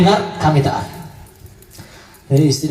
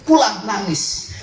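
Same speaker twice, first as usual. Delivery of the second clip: ordinary talk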